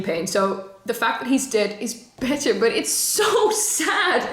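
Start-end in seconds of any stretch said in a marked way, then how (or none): 2.5-4.3 slow, much higher, a laugh breaking into sad; tearful laugh; laughing through tears. Real, not acted. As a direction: stretch it out, half laugh half cry.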